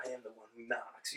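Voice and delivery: barely voiced, low intimidating line. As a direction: drop very low and soft, quietly menacing. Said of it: "sound mad intimidating without having the curse".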